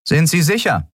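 suspicious guard. A narrator's reading. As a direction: press him, you don't believe it.

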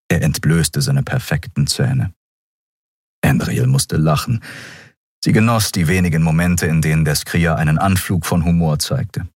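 ordinary delivery

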